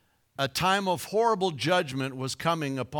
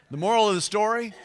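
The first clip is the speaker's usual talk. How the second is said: higher, louder, fast punchline lead-in; emphatic announcement; 7 semitones higher, 5 dB louder, faster than usual. Shown as a demonstration. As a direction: announce it with a big excited lift. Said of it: "I got a little ahead of myself there".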